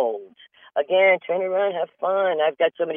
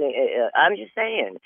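ordinary talk

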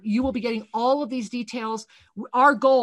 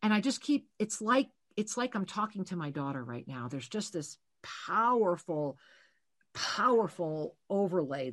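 soft channeled reading; 4 semitones lower, 5 dB quieter than usual. Acted. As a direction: lay the message on her gently, hushed. Continues reading in hushed voice.

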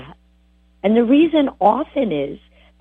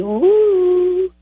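spooky woo; 10 semitones higher than usual. Pretend. Mocking mental telepathy.

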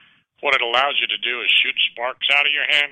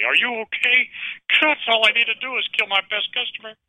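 high, alarmed voice for the manager; imitating a fretful manager; worried manager voice; 12 semitones higher than usual. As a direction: play the manager rushing over in a panic, pitched up. Manager rushing over worried.

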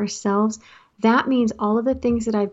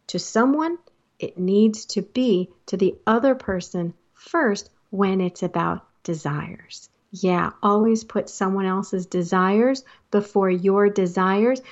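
usual speaking voice

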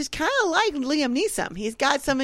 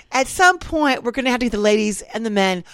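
ordinary talk

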